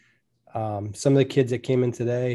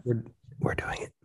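unvoiced conspiratorial whisper; 7 dB quieter than usual. Real, not acted. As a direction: whisper it like a secret. Aside, secret claim.

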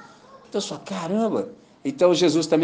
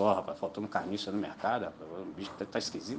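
worried mutter; 10 semitones lower, 13 dB quieter, faster than usual. Pretend. Vulture worried, fading.

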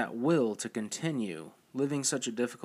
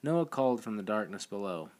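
usual speaking voice

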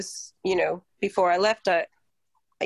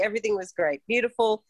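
ordinary talk

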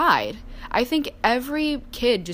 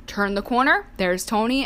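ordinary delivery

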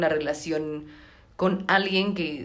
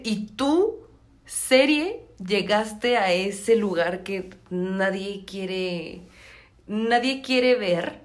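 drawn out, scolding the series; mock accusation; much slower than usual. Acusa a la serie dramáticamente. (reproche teatral, lento y agudo)

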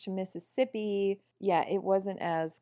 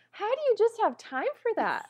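incredulous; 12 semitones higher than usual. Retold or imitated. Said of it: "and I'm like".

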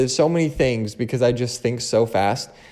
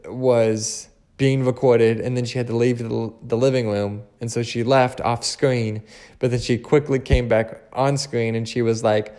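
ordinary talk, nothing marked

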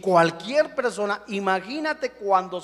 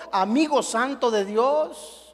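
normal talking voice

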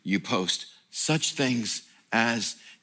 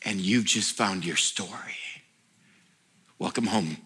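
low, softer, tender; tender voice of God; 5 semitones lower, 3 dB quieter than usual. Retold or imitated. God welcoming the pastor home, low and tender. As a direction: gently welcome him home.

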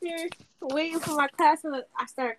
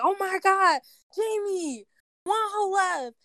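higher, urgent shocked call; shocked news; 7 semitones higher, slower than usual. Retold or imitated. Telling roommate Jamie the news, a higher shocked call.

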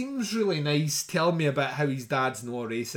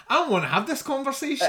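dismissive; 10 semitones higher, faster than usual. Retold or imitated. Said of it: "I'm like that".

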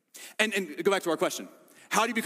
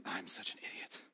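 whispered thought; 8 semitones lower, 12 dB quieter than usual. Retold or imitated. Peter thinking to himself.

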